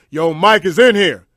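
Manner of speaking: slow, loud call from the back; loud warning call. Retold or imitated. Guy in back warns loudly.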